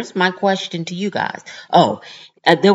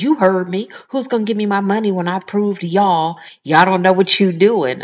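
loud, fast, indignant comeback; angry retort; 4 semitones higher, 6 dB louder than usual. Retold or imitated. Rude shopper snapping at the store manager, loud and indignant. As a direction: snap back at the manager as if insulted.

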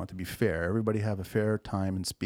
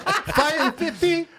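high, slow, laughing "five fifty?"; disbelieving squeal at the number; laughing outrage at a tiny fine; shocked disbelief; 7 dB louder, slower than usual. Real, not acted.

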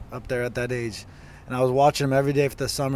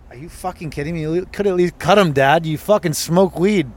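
louder, higher, exasperated rant at dad; outraged at dad; 4 semitones higher, 7 dB louder than usual. Retold or imitated. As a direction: let him have it for ripping the plants out. Yelling at dad over plants.

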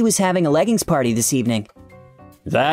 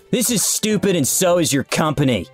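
higher, contemptuous parting shot; spat angrily; 6 semitones higher than usual. A narrator's reading. Fired supervisor storming out, spitting contempt. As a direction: spit it out as a last insult. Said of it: "he spat as he stormed out".

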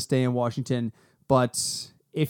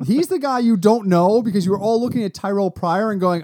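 usual speaking voice

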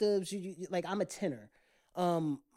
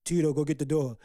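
lower, louder, blunt offstage voice; 4 semitones lower, 6 dB louder than usual. Retold or imitated. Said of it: "behind closed doors, he'd be like".

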